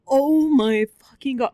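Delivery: loud, high burst of dismay; shocked exclamation. Retold or imitated. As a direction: exclaim it loudly in stricken disbelief. Retold shocked reaction to dog dying.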